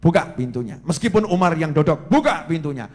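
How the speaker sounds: loud, resolute order; gallant command. Acted. Leader orders the door opened for Umar, gallant and loud. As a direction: order it boldly, without fear.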